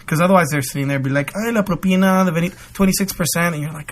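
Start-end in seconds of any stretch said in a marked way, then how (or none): 1.3-3.5 higher, quick mock nagging; pestering tip-seeker. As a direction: rattle off a pushy demand for a tip. Mimicking workers pestering for tips.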